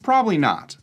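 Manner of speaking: ordinary talk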